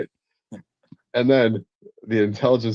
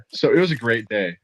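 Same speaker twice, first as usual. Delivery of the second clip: ordinary delivery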